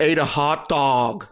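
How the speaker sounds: put-on slow, drawn-out New York accent; mock New York accent. Host practicing on his co-host, mock New York accent. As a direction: stretch each word in put-on New Yorkese. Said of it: "I was just practicing the accent".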